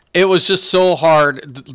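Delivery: usual speaking voice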